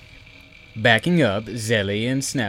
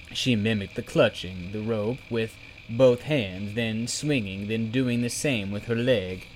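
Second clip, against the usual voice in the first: ordinary delivery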